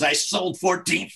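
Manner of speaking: gravelly voice. Retold or imitated. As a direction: growl it like a mafia heavy. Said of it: "he had this gravelly voice".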